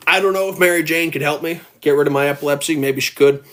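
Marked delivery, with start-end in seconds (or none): none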